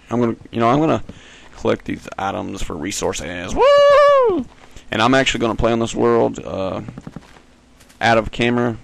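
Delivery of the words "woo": loud, very high drawn-out woo; playful falsetto whoop. Pretend. Whoops while playing.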